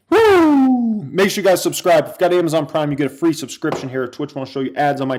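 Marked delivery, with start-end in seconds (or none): 0.1-1.0 high, falling woo; celebratory whoop. Real, not acted. Streamer happy about buying Palantir, high whoop. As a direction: let out a happy cheer.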